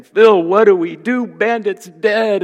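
high, pleading mock cry; acted-out panicked plea, high-pitched; imitated distraught wail. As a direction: wail helplessly, stretching out the end. Men panicking over the dead dog.

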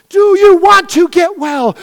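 slow, loud, high shout; shouted challenge. Acted. Pastor challenging the church, slow shouted question. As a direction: hurl the question at them.

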